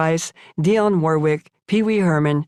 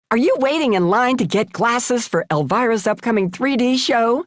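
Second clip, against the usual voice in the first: pushy reporter voice, pitched up; mock braying reporter; 6 semitones higher than usual. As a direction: bray the question like a nosy TV reporter. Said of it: "she brayed".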